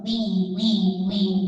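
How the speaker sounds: stuck tape repeating; like a scratched CD; skipping cd. Retold or imitated. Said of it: "volvían a decir".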